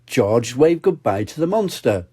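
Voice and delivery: ordinary delivery, nothing marked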